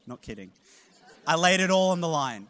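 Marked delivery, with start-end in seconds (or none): 1.3-2.5 fired up. Real, not acted. Preacher building to the punchline.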